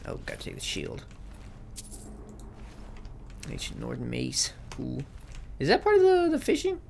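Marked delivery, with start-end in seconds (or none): 5.6-6.8 very high surprised question; surprised squeak. Real, not acted. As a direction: squeak it in disbelief.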